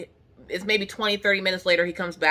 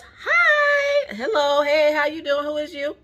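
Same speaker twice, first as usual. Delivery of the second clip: exaggerated high hello; over-the-top high-pitched greeting; 10 semitones higher, slower than usual. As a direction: sing it out high and drawn out, then ease off. Acted greeting of a newcomer entering.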